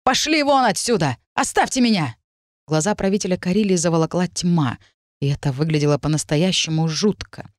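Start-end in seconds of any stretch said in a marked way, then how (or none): 0.0-2.1 higher, furious shouting; angry shout. A narrator's reading. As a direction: drive them out in rage. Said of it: "с такой злостью, что и не передать".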